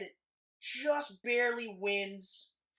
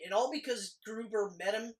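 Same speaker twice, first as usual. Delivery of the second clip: usual speaking voice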